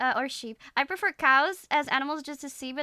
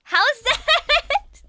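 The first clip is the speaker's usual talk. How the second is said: high, loud burst of laughter through the words; laughing; 11 dB louder than usual. Real, not acted. Streamer checking her accent attempt, laughing loudly. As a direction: ask it while cracking up, pitch shooting up.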